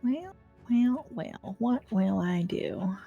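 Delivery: usual speaking voice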